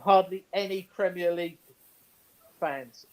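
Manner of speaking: usual speaking voice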